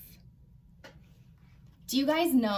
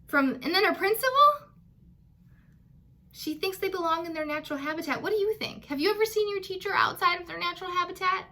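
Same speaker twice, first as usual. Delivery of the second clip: higher, louder and faster, bubbling over; excited recap; 7 semitones higher, 3 dB louder, faster than usual. Real, not acted.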